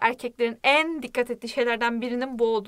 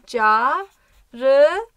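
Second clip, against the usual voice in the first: çarıcimaseyo stretched out very slowly, each syllable apart; much slower than usual. Shown as a demonstration. Teacher writing the word out while saying it slowly. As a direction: spell out çarıcimaseyo slowly for the class. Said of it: "Yazayım şöyle".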